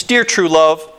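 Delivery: ordinary delivery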